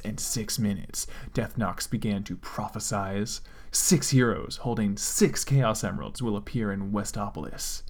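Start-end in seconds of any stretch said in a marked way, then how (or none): none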